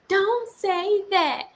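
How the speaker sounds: put-on high, slow, drawn-out child protest; imitated child. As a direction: mimic a kid's long-drawn objection. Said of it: "Jay like".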